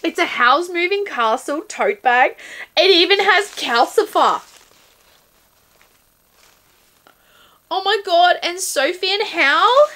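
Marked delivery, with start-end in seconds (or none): 2.8-9.9 high, loud rush of delight; excited gushing. Real, not acted. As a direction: gush over every detail. Gushing over the tote bag.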